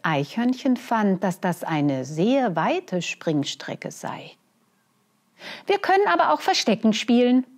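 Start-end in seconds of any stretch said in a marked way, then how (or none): 5.7-7.5 high, fast and eager; excited suggestion. Acted. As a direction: blurt out the idea quickly. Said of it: "schlug es plötzlich vor".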